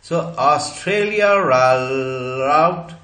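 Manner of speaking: ऑस्ट्रेलिया all out drawn out slowly with an r joining ऑस्ट्रेलिया to all; ऑस्ट्रेलिया all out slowed right down with the r glide clearly heard; linking r sound. Shown as a demonstration. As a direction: sing it slow and let an r bridge the words. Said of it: "र आ गया यहां".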